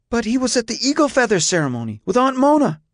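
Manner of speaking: put-on high, fast young voice; protesting boy. A narrator's reading. Son objecting to his father about the ceremony, high protest. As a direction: blurt it out in a high-pitched protest.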